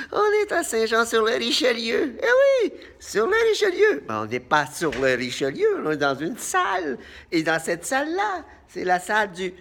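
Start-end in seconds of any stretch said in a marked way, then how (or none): 0.1-4.0 excited announcing. Acted. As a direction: belt it out like a clown opening a show. Clown announcing location to audience.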